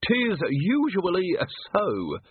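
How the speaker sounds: put-on high, drawn-out old man; knowing old yokel, slowly; slow old keeper.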